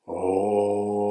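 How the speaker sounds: a long held "oh"; steady practice tone; sustained oh. Shown as a demonstration. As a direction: let "oh" ring out on the breath. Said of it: "take a deep in breath".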